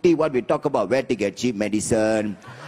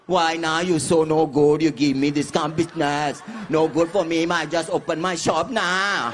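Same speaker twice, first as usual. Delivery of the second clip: put-on Thai accent; 3 semitones higher than usual. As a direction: plead like a Thai stallholder, stretching the endings. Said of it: "Thai people will calm you down".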